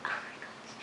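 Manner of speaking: stunned whisper; a disbelieving whisper to the viewers. Real, not acted. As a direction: breathe it out with no voice. Reacting to a funny line.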